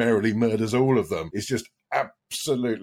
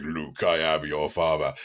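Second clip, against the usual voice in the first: Darth Vader quote; 5 semitones lower than usual. Retold or imitated. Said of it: "best movie quote ever".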